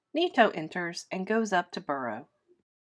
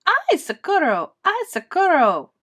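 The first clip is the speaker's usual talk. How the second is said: panicked cry for help; 9 semitones higher, 9 dB louder than usual. Acted. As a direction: scream for help while fleeing. Farmer chased again.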